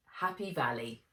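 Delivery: ordinary delivery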